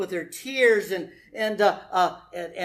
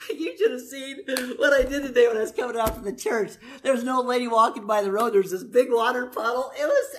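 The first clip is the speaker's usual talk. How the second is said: laughing hard, voice climbing high and breaking; can barely get the words out for laughing; laughing while telling; 5 semitones higher than usual. Real, not acted. Laughing while telling funny story.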